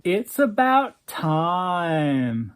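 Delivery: very slow pretentious drawl; airy drawl. Acted. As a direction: savor each word, very slow. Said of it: "in that airy drawl of his".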